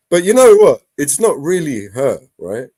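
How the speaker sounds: ordinary delivery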